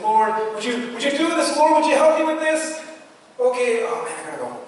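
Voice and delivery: a busy believer cutting God off; hurried pray-er. Pretend. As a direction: pray in a hurry and bolt.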